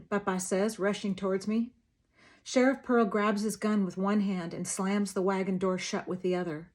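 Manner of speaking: plain reading voice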